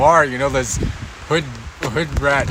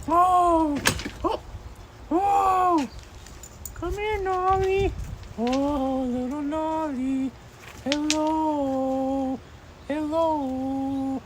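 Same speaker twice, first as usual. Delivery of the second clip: cooing pet talk; 10 semitones higher, 7 dB quieter, much slower than usual. Real, not acted. Cooing to little Nolly, high soft baby talk. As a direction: coo up high and soft to a puppy.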